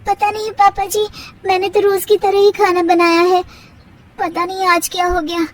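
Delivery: daughter-in-law Tara brushing off her mother-in-law; young daughter-in-law. Acted. Tara answers.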